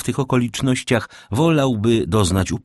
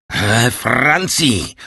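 very slow, higher-pitched exclamation; outraged cry; 5 semitones higher, 4 dB louder, much slower than usual. A narrator's reading. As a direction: cry out in anger and alarm, stretching the words. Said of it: "zakrzyknął".

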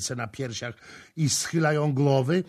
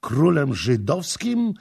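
high, loud, drawn-out question; raised, slow, astonished query; incredulous echo; 5 semitones higher, 6 dB louder, slower than usual. Acted. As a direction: stretch the words in disbelief. Prince echoes the claim in disbelief.

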